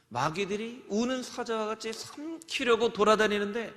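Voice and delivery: usual speaking voice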